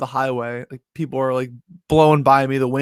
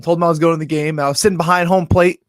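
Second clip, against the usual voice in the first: normal talking voice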